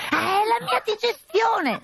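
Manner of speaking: high, slow, contrite falsetto lady; contrite fake bride. Arlecchino excusing the noise to the captain, high and contrite. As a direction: sound solemnly sorry in the high disguise.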